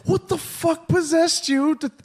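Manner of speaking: high, slow, strained disbelief; baffled exasperation. Retold or imitated. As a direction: drag it out, utterly baffled. Exasperated rant at wife.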